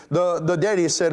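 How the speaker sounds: normal talking voice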